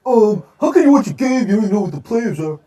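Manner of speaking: high, whiny know-it-all voice; mock the nitpicking viewer; snotty commenter. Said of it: "everybody trashing me".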